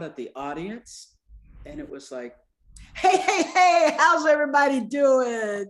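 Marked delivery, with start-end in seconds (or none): 2.9-5.7 loud, much higher, laughing stage hello; stand-up comic greeting. Retold or imitated. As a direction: work the crowd like a comic opening a set.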